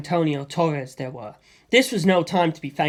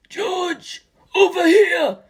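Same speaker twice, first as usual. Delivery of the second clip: a high call, louder on the second part; 13 semitones higher, 4 dB louder, much slower than usual. A narrator's reading. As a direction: call him over across the cave. Stephen waves across the harbour.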